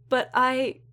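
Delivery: high, upset objection; distressed protest. A narrator's reading. Upset Nura protests, is cut off.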